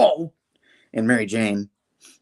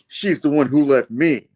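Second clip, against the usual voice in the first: higher, louder retold complaint; acted accusation; 4 semitones higher, 5 dB louder than usual. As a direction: protest in character, pitched up and louder. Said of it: "he's like".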